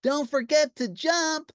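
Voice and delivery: high, slow call after him. A narrator's reading. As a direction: shout the warning down the hill. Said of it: "cried Lucretia".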